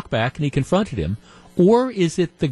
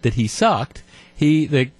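ordinary talk

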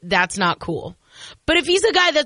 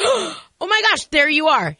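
delighted; 7 semitones higher than usual. Retold or imitated. Delighted reaction to a text.

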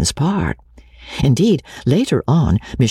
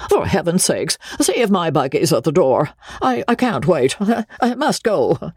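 stammering, rising higher in a flustered rush; a flustered man desperate to get away; lightly voiced panic, stammering I, I; panicked; 6 semitones higher than usual. A narrator's reading. Joseph panics to escape.

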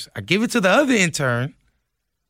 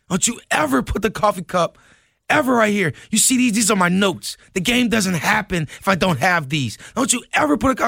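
higher-pitched retold scolding, a mock tirade at the intern; angry diva rant; 6 semitones higher than usual. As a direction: scold him like a prima donna flipping out. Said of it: "He flips out".

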